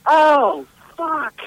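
mock panicked woman. Retelling actress before jumping.

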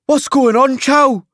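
high, loud Geordie shout; strong Geordie accent. A narrator's reading. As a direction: call out in broad Geordie. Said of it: "in his strong Geordie accent".

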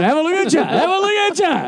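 high, teasing exclamation; mock outrage. Host ribbing co-host about talcum powder, high mock outrage. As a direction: pitch it up in playful disbelief.

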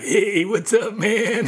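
high, laid-back hippie drawl. Retold or imitated. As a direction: greet like a stoned old hippie. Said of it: "he had the classic hippie".

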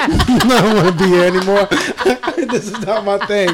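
quoting himself while cracking up; laughing through quote. Retold or imitated. Retelling leaving the bad kisser.

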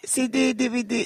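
mock DVD seller, high; mock Chinese seller. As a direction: hawk bootleg DVDs in a put-on accent. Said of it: "mock seller".

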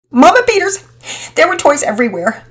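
put-on tearful childish complaint; rising upset. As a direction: voice climbing in frustration. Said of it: "voice continued to rise".

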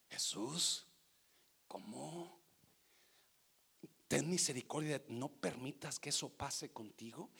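very soft, low acted pleading; stunned disciple's quiet protest, imitated; hushed plea. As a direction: plead privately, almost under the breath. Voices Peter pleading with Jesus.

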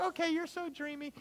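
high, soft, lovestruck sigh; swooning Nala. Retold or imitated. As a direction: melt like a smitten cub. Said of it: "And she's like".

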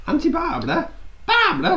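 high, rising disbelief; incredulous. Real, not acted. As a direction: squeak it out in disbelief. Incredulous at an odd answer.

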